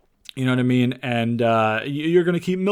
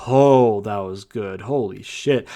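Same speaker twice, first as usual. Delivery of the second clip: impressed; 4 semitones lower, slower than usual. Retold or imitated. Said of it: "then you're like".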